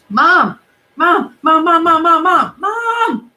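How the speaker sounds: loud, high shouts for mom; frantic calling. Acted. Benji shouting for his mom from the curb, loud and high. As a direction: yell for her across the yard, rising.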